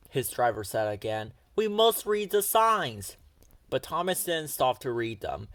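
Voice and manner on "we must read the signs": louder, higher, slow insistent call; urgent call. A narrator's reading. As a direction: insist over the rattle of the train. Driver urging speeding engine.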